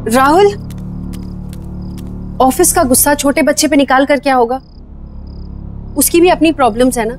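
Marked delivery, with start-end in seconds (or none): 2.4-7.1 reproachful. Acted. Wife reproaching husband.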